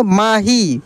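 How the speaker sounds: माही joined, with a lengthened final ī; drawn-out long ī. Shown as a demonstration. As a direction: blend माही and stretch the ending. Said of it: "खींच कर किया जाता है". (माही की ई लंबी)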